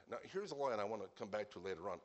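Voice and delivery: normal talking voice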